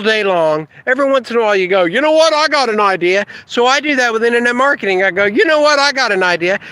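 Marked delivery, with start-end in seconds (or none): 1.8-3.2 mock eureka. Mechanic having an idea. 5.2-6.6 mock eureka. Himself having an idea.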